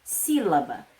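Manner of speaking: "sílaba" with the first syllable stressed, falling away. Shown as a demonstration. Teacher gives pupils an example word, stress on first syllable. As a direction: lean on the "sí" of "sílaba". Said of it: "A exemplo dessa palavra mesmo".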